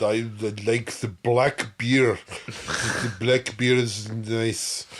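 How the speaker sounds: a slow, lightly put-on Russian accent; retold Russian accent. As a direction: drag it out like a Russian ordering beer. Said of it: "the made up accent".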